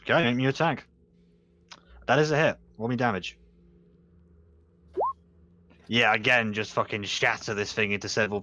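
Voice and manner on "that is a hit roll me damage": ordinary delivery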